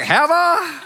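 high, loud incredulous question; mock surprise. Pastor reacts to the drunk man's praise, high and surprised. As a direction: feign shock at the praise. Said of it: "I was like".

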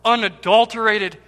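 loud, impassioned sermon delivery; forceful preaching. Real, not acted.

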